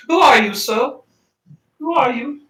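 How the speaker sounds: put-on high, hammy German accent; a bad German accent on a child; poor German accent. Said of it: "I give him a little poor German accent".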